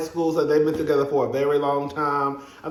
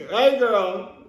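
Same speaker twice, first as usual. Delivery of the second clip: bubbly greeting; 7 semitones higher than usual. Retold or imitated. Imitating greeting energetic Gertie.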